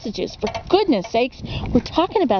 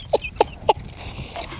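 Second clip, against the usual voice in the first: laugh; 6 dB quieter than usual. Real, not acted. After her own impression.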